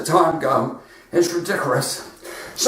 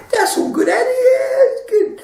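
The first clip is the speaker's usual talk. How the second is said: imitating Joe Pasquale.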